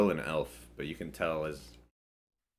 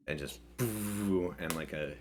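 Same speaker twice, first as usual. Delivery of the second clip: ordinary talk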